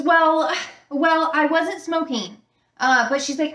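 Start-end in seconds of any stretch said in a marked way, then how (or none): 0.0-2.4 put-on defensive girl. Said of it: "she goes".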